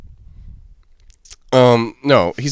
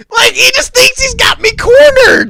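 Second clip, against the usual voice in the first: a much higher, louder laughing shout that nearly cracks; 6 dB louder than usual. Real, not acted. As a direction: crack up mid-rant. Retelling the standoff, laughing hard.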